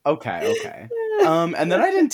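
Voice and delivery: giggling through the words; laughing. Real, not acted. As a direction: still laughing, rushing on. Laughing after the poem.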